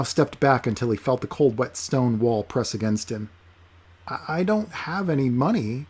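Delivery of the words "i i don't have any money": nervous. Acted. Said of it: "he answered nervously".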